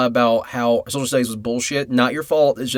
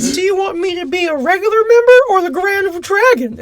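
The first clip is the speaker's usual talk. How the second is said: much higher, loud cartoon yell; cartoon paperclip shout; 6 dB louder than usual. Paperclip voiced as a racist.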